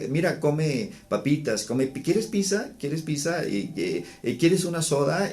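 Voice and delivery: mild mock grandma offering treats; imitated doting grandmother. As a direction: lightly play a grandma bribing with snacks.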